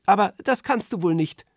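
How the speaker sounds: put-on a curious kid pipes up; child voice. A narrator's reading. Children asking Berti about conducting, lightly voiced kid.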